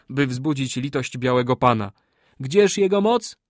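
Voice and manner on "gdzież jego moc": "Gdzież" much higher and louder, mocking rising question; mocking taunt. A narrator's reading. Kali ridiculing the sorcerer before the warriors, loud mocking taunt. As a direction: sneer "Gdzież jego moc?" with a loud rise.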